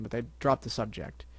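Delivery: ordinary delivery, nothing marked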